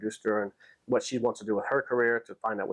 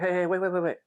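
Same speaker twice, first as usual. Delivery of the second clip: higher, repeated plea to wait; urgent calling back; 8 semitones higher than usual. Retold or imitated. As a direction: imitate someone urgently stopping people leaving. Man calling them back, retold.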